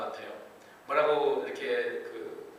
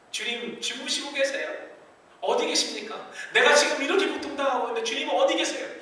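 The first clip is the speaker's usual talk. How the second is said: high, louder pleading cry; pleading psalmist; 12 semitones higher, 8 dB louder than usual. Acted. Psalmist crying out to a silent God, high pleading. Psalmist's plea voiced by preacher.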